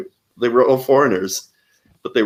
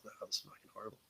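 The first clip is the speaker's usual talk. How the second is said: whispered private verdict; inner thought; 28 dB quieter, much faster than usual. Retold or imitated. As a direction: breathe it out under your breath. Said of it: "thinking to myself".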